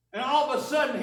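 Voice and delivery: ordinary talk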